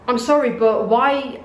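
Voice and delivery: normal talking voice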